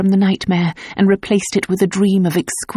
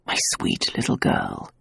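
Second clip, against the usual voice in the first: soft tender whisper; husband whispering comfort; 9 dB quieter than usual. Acted. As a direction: whisper it into her hair. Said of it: "he whispered".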